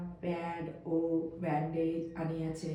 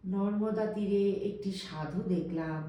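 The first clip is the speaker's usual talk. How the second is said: ordinary delivery, nothing marked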